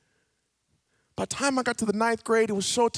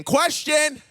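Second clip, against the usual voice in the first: sudden loud call-out, higher; kid calling out; 7 semitones higher, 7 dB louder than usual. Retold or imitated. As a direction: blurt it like an eager kid in class. Kid's hand shoots up in class.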